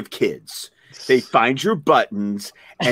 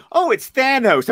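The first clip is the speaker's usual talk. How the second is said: put-on higher, slower little-kid voice; imitating a little kid; 10 semitones higher than usual. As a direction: lightly play an eager five-year-old. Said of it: "my five-year-old looking at the shirt today going".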